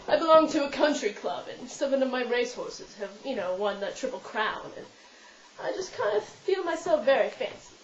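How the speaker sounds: put-on upper-crust British, country-club airs; fancy British accent. Shown as a demonstration. Vlogger posing as a country club member, fancy British accent. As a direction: play a very fancy British racehorse owner. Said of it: "go into our regional British accent".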